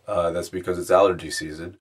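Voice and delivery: ordinary talk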